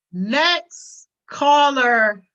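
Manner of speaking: milk "next caller" like a host cutting someone off; mock radio host. Streamer hanging up on chat as a mock host, dragged out. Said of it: "I'm hanging up this line".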